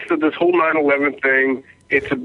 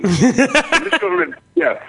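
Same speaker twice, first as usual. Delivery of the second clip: mock high voice; 12 semitones higher than usual.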